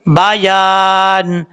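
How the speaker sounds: بیان drawn out with a clear یا. Shown as a demonstration. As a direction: stretch بیان, ya sounding plainly. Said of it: "اس یا کو clear کرنا ہے". (بیان میں یا صاف اور کھینچ کر)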